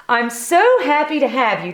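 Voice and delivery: fast, gushing hostess voice; delighted hostess. Acted. Missus Moose welcoming Turkey, gushing. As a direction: gush with delight.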